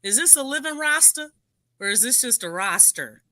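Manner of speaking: usual speaking voice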